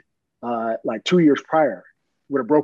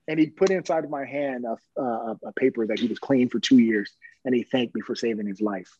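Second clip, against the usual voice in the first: ordinary delivery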